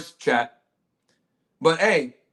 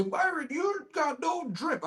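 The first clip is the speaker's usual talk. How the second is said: mocking critic; 9 semitones higher, 6 dB quieter, much slower than usual. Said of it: "people try to make jokes and say".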